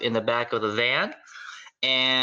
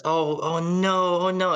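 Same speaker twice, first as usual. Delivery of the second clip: high, slow mom panic; higher mock alarm as food burns; panicked mom; 8 semitones higher, 3 dB louder than usual. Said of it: "It's just".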